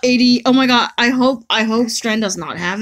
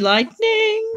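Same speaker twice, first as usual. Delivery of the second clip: high squeal; 12 semitones higher than usual. Real, not acted. Cheering guaranteed lightning.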